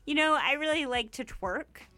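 lightly put-on Canadian politician voice; a hint of a stiff Canadian leader; mock Canadian Harper accent. Imitating Harper's Canadian accent at a speech. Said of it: "That's my Canadian Harper accent".

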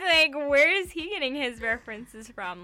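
amused, teasing giggle; laughing. Real, not acted. Cohost laughing while mocking the prime minister's browser history.

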